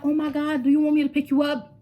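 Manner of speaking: lightly voiced concerned mother; worried mom. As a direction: imitate a worried mother offering a ride. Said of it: "So she's like".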